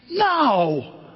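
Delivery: high, playful "No"; mock refusal. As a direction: scoff at the idea. Joking about Cardinals gear.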